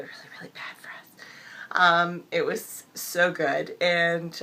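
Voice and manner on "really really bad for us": fast whispered aside, voice gone; quick whisper. Real, not acted. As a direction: rush it out in a whisper. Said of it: "excuse the gravelly sound and the whispering".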